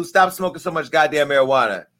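usual speaking voice

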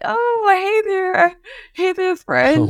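very high, sugary friendly greeting; sweet-talking hiker. Retold or imitated. Hungry hiker charming rafters with sugary greeting. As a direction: sweeten them up for a ride. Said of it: "you're like".

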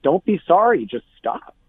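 retold scolding, pitched high and loud, then dropping; mock-exasperated telling-off, jumping up at the start; exasperated retort.

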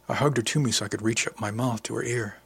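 ordinary delivery

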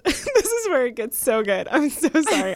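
amused, words breaking into laughter; laughing apologetically. Real, not acted. Host apologising to letter writer for laughing at the situation, laughing. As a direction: try to apologise while laughing. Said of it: "I'm not laughing at you".

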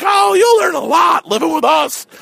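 put-on high, loud squealing girl voice; teasing party girls. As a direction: shout it like a gang of giggly roommates.

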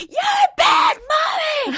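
put-on high, loud, slow shouted child voice; toddler shout. Mom imitating her daughter screaming at her in the park, high and loud. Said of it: "she goes".

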